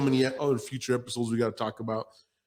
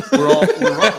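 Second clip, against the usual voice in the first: mocking laugh; 11 dB louder than usual.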